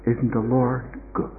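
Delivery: musing quiet repetition; hushed echo. Retold or imitated. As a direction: repeat it quietly in awe. Preacher repeats her words, reflecting.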